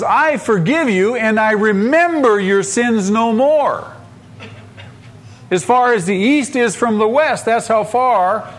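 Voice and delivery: high, ringing divine promise; emphatic proclamation. Acted. Voicing God's emphatic promise.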